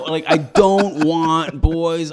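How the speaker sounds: high, loud, half-laughing protest; shocked yelling denial. Retold or imitated. To a street dealer offering boys, a laughing high loud protest. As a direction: blurt it out, laughing in disbelief.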